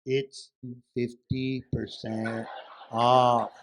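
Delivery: "It's... fifty percent... off", low and word by word; mock-patient, spaced-out "fifty percent off"; exaggeratedly slow answer. Wife answers back just as slowly.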